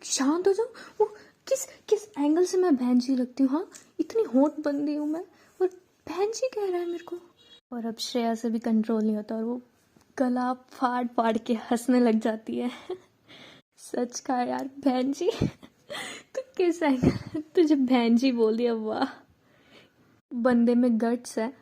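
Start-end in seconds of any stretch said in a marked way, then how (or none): 0.0-7.2 higher, put-on outraged diva voice; wounded pride, still fuming; indignant fuming. Megha fuming to Shreya about being called sister, outraged. 12.9-21.5 teasing through laughter, voice shifted; cracking up while mocking; laughing teasing. Shreya laughing at Megha after the guy's remark, teasing.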